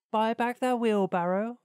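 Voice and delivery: high, loud incredulous question; astonished miller. Acted. Miller astonished Hans sold wheelbarrow.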